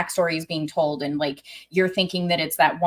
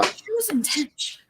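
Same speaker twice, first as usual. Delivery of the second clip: much higher but softer; a fan reeling from a tragic backstory, high and soft; awed squeak; 14 semitones higher, 5 dB quieter than usual. Real, not acted. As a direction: breathe it out high, still overwhelmed. Gushing over a tragic story.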